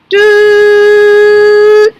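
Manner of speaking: very long, loud, high doot; tekiah trumpet blast. Shown as a demonstration. Teacher ending the travel signal, long trumpet blast. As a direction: hold one steady horn note to finish. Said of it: "then the tekiah".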